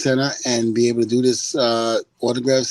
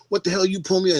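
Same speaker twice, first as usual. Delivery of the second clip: higher-pitched mock defiance; puffed-up protest, pitched up; acting big and bad; 7 semitones higher, much faster than usual. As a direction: imitate an indignant driver talking back. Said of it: "they're acting all big and bad".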